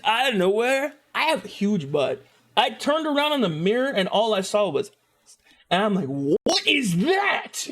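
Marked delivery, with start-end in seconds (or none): 6.4-7.7 high, drawn-out mock disbelief; mock shock. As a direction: stretch it out in exaggerated alarm. Acts out shock at mirror.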